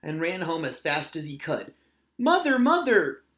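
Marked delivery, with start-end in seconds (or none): 2.2-3.2 high, loud call for mother; crying out. A narrator's reading. Little otter running home calling for his mother. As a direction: call her urgently from the doorway. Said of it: "he cried".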